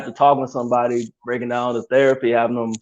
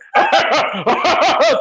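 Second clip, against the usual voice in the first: creepy held-in laugh; 13 semitones higher than usual. Shown as a demonstration. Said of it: "I might kill somebody laugh".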